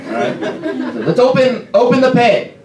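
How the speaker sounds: high, loud frontman hyping the crowd; rally the mosh pit, then fuss over its shape; OCD punk singer. Acted. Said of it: "my impression of a, the lead singer of a punk band who is O C D".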